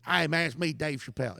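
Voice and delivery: a lightly voiced, self-aware bad Chappelle impression; terrible Dave impression. Comic retelling a club story, doing a bad Dave voice. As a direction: toss off a knowingly bad impression. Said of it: "it's a terrible impression".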